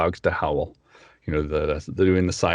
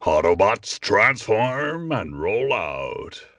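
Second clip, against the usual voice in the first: slow, commanding robot leader; Optimus Prime impression; 3 semitones higher, much slower than usual. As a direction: command the troops slowly and grandly. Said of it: "let me see".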